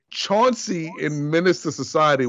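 ordinary delivery

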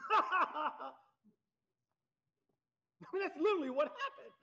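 talking through real laughter, fast; laughing. Real, not acted. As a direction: let the laugh spill into the words. Laughing at the story.

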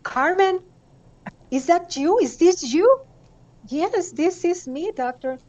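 high, loud astonished questions; overwhelmed, voice leaping up in wonder; disbelieving reunion, high and excited; amazed disbelief. Retold or imitated. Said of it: "I said".

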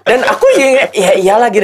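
proud laughing reply. Real, not acted.

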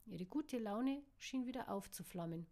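ordinary delivery

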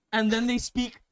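ordinary talk

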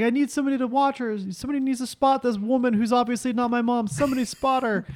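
high-pitched appeal for help; mock urgent plea. Trainer staging a call for a spotter, mock urgent. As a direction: call out high, as a staged emergency.

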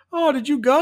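high, loud mock disappointment; Rosenberg. Podcaster imitates a film character's question, high put-on voice. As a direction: pitch it way up as a movie character. Acting Rosenberg coming up to him.

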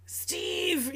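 hushed, whispered plea for Steve; mock-urgent whisper; imitated whispered call. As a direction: whisper the name as if calling secretly. Elves secretly call for help.

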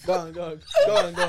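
laughing through the words; can't stop laughing. Real, not acted. Cracking up at the question.

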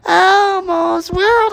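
very high, slow sing-song puppet; strained falsetto Elmo singing. Acted. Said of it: "do Elmo".

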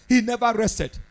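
usual speaking voice

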